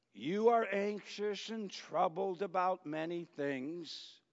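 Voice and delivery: a tender word to a busy friend; intimate rebuke. Retold or imitated. Jesus gently correcting anxious Martha, softly and slowly. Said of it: "it's very intimate".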